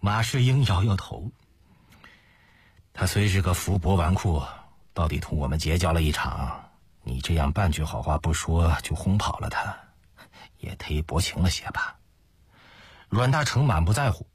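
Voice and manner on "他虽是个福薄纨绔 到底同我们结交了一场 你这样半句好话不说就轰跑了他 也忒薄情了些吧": low, measured voice of a reproachful friend; reproachful companion. Acted. Ma chides his partner for coldly sending away an acquaintance, reproachful. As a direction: reprove him in a lowered, restrained voice.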